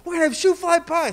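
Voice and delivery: high, loud burst of excitement; very excited. Retold or imitated. Dad hyping shoe fly pie to his son, very excited. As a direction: blurt out thrilling news about pie. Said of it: "I said it with such excitement".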